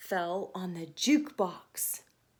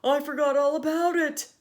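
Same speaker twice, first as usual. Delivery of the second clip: much louder, panicked realization; alarmed Tony; 4 semitones higher, 9 dB louder than usual. Acted. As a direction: blurt it like a flustered baker. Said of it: "Tony said".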